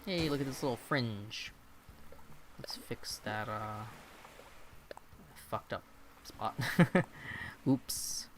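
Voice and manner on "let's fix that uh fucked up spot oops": whispered under the breath; whispered mutter. Real, not acted. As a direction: murmur it to yourself, half laughing. Quiet mutter over mistake.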